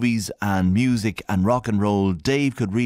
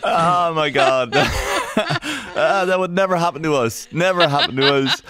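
louder, higher laughing reaction; 8 semitones higher, 4 dB louder than usual. Real, not acted. Host laughing along after playing the archive clip. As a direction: crack up at the clip. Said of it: "reacting to the clip".

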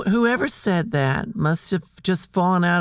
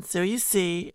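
ordinary talk

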